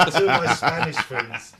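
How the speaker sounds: laughing. Real, not acted. Starts story laughing.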